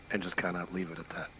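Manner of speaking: ordinary talk, nothing marked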